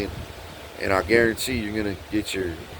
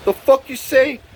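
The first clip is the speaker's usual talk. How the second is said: high, loud retold outburst; outraged challenge; 6 dB louder than usual. Him confronting the woman before the slap, outraged. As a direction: fire it back in disbelief.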